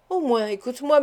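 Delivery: ordinary delivery, nothing marked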